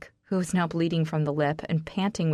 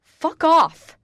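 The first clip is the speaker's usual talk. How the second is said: loud, high scream; furious scream; 10 semitones higher, 10 dB louder than usual. Acted. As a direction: scream him away. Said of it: "I scream".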